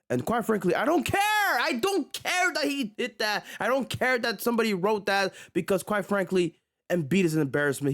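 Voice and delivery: high-pitched exasperated venting; exasperated rant. Real, not acted. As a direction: throw your hands up and vent. Ranting about a columnist.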